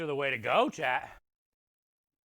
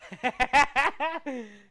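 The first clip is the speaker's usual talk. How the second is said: loud, high pained laugh; pained laughter; 9 semitones higher, 8 dB louder than usual. Real, not acted. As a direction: laugh through the burning heat. Reacting to ghost pepper heat.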